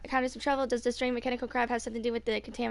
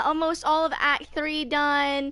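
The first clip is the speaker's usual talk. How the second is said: usual speaking voice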